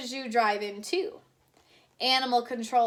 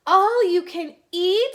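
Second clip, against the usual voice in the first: much higher and louder, thrilled; thrilled disbelief; 9 semitones higher, 10 dB louder than usual. Acted. Wife repeating the news, loud and thrilled. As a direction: squeal it as the best news ever. Missus Watson thrilled by food.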